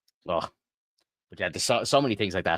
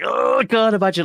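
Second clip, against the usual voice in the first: much higher, louder gasp of dismay; overwhelmed exclamation; 13 semitones higher, 9 dB louder than usual. Retold or imitated. Father retelling his cinema reaction, a high, loud overwhelmed outcry. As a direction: blurt it out like the thought just hit you. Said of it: "I'm sitting in the chair just like".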